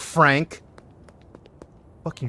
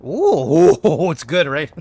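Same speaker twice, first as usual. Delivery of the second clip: loud delighted ooh into a laugh; amused, laughing reaction; 7 dB louder, slower than usual. Real, not acted. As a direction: crow it, then break into laughter.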